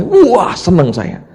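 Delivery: high, loud, drawn-out mock delight; imitated gleeful relish. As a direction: play a friend relishing the contest. Boastful friend relishes a political contest. (menirukan orang kegirangan, suara tinggi)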